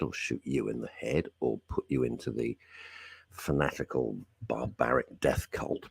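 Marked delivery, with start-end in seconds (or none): 4.5-5.8 whispered. Real, not acted. Whispered dark aside.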